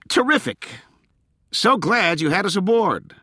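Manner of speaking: very high, mock-delighted sarcasm; high-pitched sarcastic gratitude. A narrator's reading. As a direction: feign delight at terrible news. Corky mocks the alarming news.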